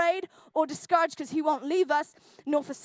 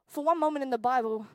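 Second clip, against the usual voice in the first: usual speaking voice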